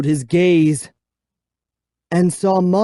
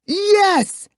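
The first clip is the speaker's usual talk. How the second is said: high triumphant yes; boy cheering at being home; 3 dB louder than usual. A narrator's reading. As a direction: punch the air with it. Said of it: "I cheered".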